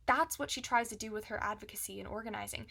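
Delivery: normal talking voice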